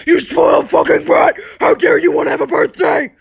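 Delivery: high, loud screaming tirade; mother's furious shout. Retold or imitated. Mom kicking daughter out over a birthday fight, furious shouting. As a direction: yell it like a mom throwing someone out. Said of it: "kicking me out of the house and said".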